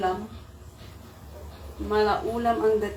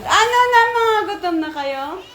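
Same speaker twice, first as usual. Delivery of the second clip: loud, high-pitched call; high, loud singsong summons; 9 semitones higher, 11 dB louder than usual. Real, not acted. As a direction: shout the question out to the household. Calling out to the hungry ones.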